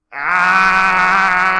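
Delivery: high shouted robot scream; robot death cry. Acted. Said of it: "cried out giant Robot Redcoat".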